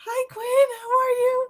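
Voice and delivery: high, laughing hello; laughing greeting. Real, not acted. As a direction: say hi through the laughter.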